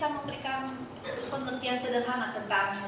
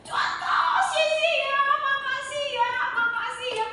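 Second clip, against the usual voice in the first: much higher, thankful woman's voice; grateful woman; 3 dB louder than usual. Retold or imitated. Woman hugging the preacher, thanking him in a high voice. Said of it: "dia peluk saya".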